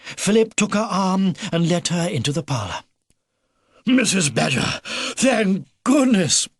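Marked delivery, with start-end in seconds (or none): 3.9-6.5 high, slow relieved exclamation; relieved cry. A narrator's reading. Mister Edgar greeting soaked Missus Badger, a relieved cry. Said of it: "cried Mister Edgar".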